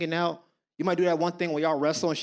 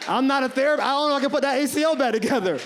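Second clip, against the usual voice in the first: mock outrage; 6 semitones higher, faster than usual. Mock-outraged riff on heartbreak.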